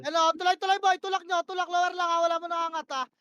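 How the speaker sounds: loud, high, urgent calls; yelled commands. Real, not acted. Shotcaller screaming push orders mid-fight. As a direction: bark the push at the squad.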